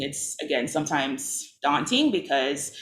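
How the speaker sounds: ordinary talk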